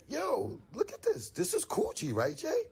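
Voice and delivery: high, hyped-up calling out to his friend; excited. Retold or imitated. Showing his style guy the rack, high and hyped. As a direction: squeal it like you just found treasure. Retelling own excited shout to Jay.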